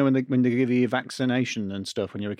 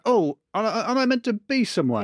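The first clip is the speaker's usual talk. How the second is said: acted fomo; 9 semitones higher than usual. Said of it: "I get intense fomo".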